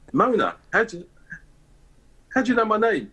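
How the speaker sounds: high-pitched mock astonishment; imitated amazement. Son retelling his mum's reply to His Royal Highness, imitated amazement. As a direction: act out mum's amazed question, pitch jumping right up.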